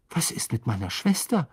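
ordinary delivery

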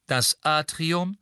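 slow, detached lift announcement; cool female announcer. A narrator's reading. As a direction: announce the floor coolly and slowly. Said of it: "sagte die kühle Frauenstimme".

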